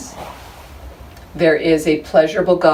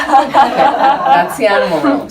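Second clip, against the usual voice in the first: higher and slower, laughing through the words; 10 semitones higher, 5 dB louder, slower than usual. Real, not acted. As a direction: laugh it off as you wrap up. Laughing at the scary smart dog.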